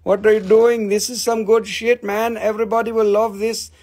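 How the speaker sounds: mock amazed collector; excited discoverer. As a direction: gush like someone finding treasure. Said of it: "somebody came and they said".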